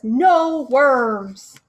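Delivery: loud, curt grouchy no; grumpy refusal. Acted. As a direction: snap it like a grumpy old bird. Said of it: "feeling very grumpy".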